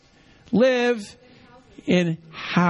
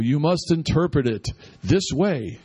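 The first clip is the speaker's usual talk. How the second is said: mock serious voice; 4 semitones lower than usual. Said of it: "this kind of serious voice".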